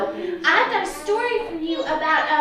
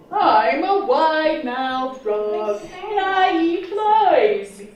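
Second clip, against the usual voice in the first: wide-mouthed frog voice; 4 dB louder, slower than usual. Acted. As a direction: boom it out like a proud frog. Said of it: "said the wide-mouthed frog".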